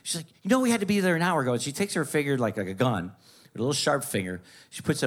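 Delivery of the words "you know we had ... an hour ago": high, fast scolding mother; nagging mom. Retold or imitated. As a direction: rattle off the complaint like an impatient mom. Mom nagging about being late.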